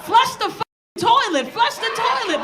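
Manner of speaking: high, slow comic outburst; exasperated order. Acted. Comedian telling men to flush before sending pictures, exasperated order.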